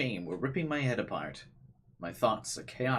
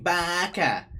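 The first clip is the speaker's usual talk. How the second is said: mock girl voice; 9 semitones higher, 6 dB louder than usual. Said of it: "that's not her saying".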